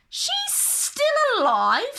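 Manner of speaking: queen screeching at the mirror. Acted. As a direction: screech in disbelief. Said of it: "screeched the queen".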